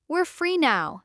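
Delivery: plain reading voice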